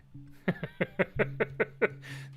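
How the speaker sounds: real high breathy laughter; helpless high laugh. Real, not acted. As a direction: burst into wheezy giggles. Laughs after abrupt goodbye.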